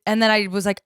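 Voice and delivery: ordinary talk, nothing marked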